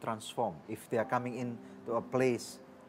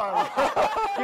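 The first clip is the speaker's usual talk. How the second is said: high laugh; 7 dB louder than usual. Real, not acted. Joking about an Australian accent.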